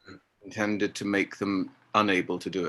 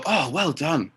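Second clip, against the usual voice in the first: polite praise; 5 semitones higher than usual. Retold or imitated. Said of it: "And so we all go".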